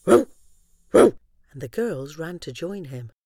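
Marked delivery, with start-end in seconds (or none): 0.1-1.2 loud woofing dog; dog bark. Acted. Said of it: "Buddy barked loudly".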